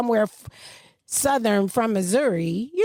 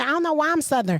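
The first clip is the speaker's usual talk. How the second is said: ordinary talk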